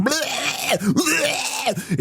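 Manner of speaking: high, strangled mock baby wail; newborn's scream. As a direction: bleat like a squalling newborn. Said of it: "It's just screaming. It's screaming".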